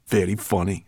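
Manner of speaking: ordinary delivery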